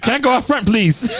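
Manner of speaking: high, comic mock Indian accent. Customer begging not to be taken out back, mock Indian accent. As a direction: plead in a put-on, high-pitched Indian accent. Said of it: "gave to my man an Indian accent".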